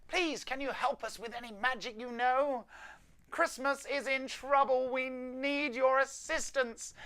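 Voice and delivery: high, bright elf pleading for help. Acted. Elf on the video call begging Tom for help, high worried voice.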